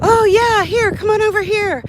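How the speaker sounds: high, loud eager welcome; eager salesman. Retold or imitated. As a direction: beckon like a keen salesman. Recruiter eagerly inviting her in.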